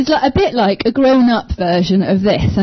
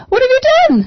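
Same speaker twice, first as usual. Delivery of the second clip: scolding; much faster than usual. Retold or imitated. God scolding Adam and Eve, not the way he said it, high and sharp. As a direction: snap it out like catching someone misbehaving. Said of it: "he wasn't saying".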